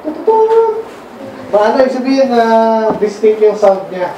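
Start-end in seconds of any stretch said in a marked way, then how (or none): 0.0-0.8 high-pitched "tututut" like a trumpet; trumpet imitation. Shown as a demonstration. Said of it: "Pag trumpet". (panggagaya sa tunog ng trumpeta)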